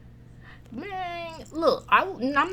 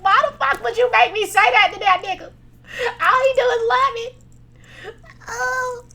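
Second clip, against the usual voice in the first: hammed-up tearful whine, much higher; mock crying wail; 12 semitones higher, 6 dB louder than usual. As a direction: pretend to wail through tears in the car. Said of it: "I would've been in the car crying".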